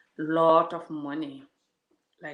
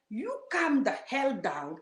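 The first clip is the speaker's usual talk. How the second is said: usual speaking voice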